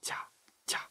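voiceless whispered ジャ, very soft; ジャ in a hushed, careful whisper. Shown as a demonstration. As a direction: whisper ジャ as a sound demonstration. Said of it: "ジャで同じことをやると".

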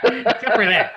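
tickled, laughing repeat. Real, not acted. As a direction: burst out laughing as you say it. Both laughing at joke.

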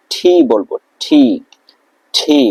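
T as ঠি with a breathy aspirated t; breathy ঠি for letter T. Shown as a demonstration. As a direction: push air on ঠি. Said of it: "ট আমরা ঠ উচ্চারণ করবো".

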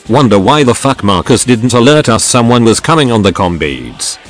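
ordinary delivery

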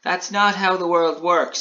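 high, slow lament; mildly sad little gingerbread man; Alan Taylor, sad. A narrator's reading. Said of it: "said Alan Taylor sadly".

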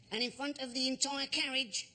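ordinary delivery

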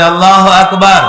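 loud rallying chant; loud takbir. Real, not acted. Preacher urging a weak-voiced crowd to respond, loud takbir. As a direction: call it out loudly for the crowd to echo. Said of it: "জোরে".

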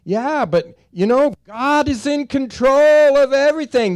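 high, loud mocking protest; voicing a doubter in the congregation, mocking; mock objector. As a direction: play the whiny doubter.

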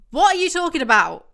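loud what are you talking about; incredulous outburst. Real, not acted. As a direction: shout at the page in disbelief. Reacting to the book.

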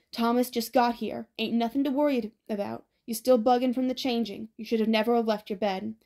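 ordinary delivery, nothing marked

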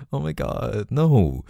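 high, swooping disbelieving protest; incredulous reassurance. Acted. Partner dismisses loved one's worry, incredulous reassurance.

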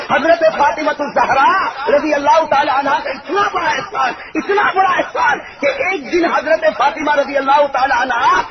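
high, ringing sermon cry; impassioned preaching. Acted. Preacher to congregation, a soaring devotional cry. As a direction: lift the crowd with the holy name.

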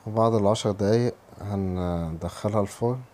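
ordinary talk

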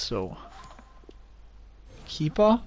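usual speaking voice

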